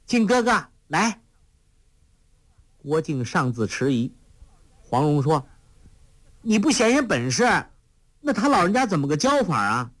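put-on a playful girl teasing her companion; lightly voiced sly young girl; lively young girl. A narrator's reading. Huang Rong voice again.

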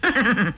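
short, mirthless villain's laugh; insincere, mocking little laugh; fake sneering chuckle. A narrator's reading. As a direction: chuckle without meaning it. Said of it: "皮笑肉不笑地说".

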